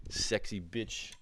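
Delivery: ordinary talk, nothing marked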